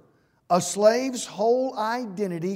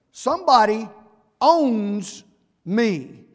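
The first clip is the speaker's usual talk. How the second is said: louder, slow declaration with space after each word; proud, deliberate declaration; 6 dB louder, slower than usual. Acted. Paul declaring whose slave he is, proud and deliberate. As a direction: say it proudly, landing on every word. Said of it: "he said it proudly, deliberately".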